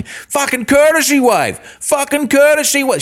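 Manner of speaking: high-pitched yelled outburst; yelling. Retold or imitated. Driver yelling at a rude motorist, high furious yell. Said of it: "I was yelling".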